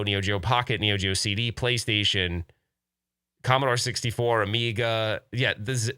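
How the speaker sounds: ordinary talk, nothing marked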